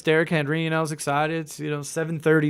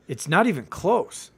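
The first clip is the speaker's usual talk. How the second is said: ordinary talk, nothing marked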